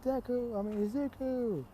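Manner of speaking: put-on very high little-kid voice; high, singsong acted greeting; child calling out. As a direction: call to a favorite grown-up in a put-on high voice. Child calls out to Deku.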